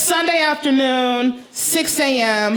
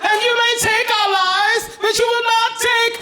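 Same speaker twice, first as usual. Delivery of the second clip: high, shouted, laughing proclamation; rally the troops in a hammed-up shout; mock rousing speech; 12 semitones higher than usual. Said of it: "I address the gentleman".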